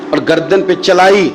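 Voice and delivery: normal talking voice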